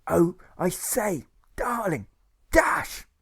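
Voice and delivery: high, affected plummy figure voice; theatrical high-pitched nurse with old-chap airs; posh dashing nurse. Acted. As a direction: exclaim grandly, then confide in an affected high voice.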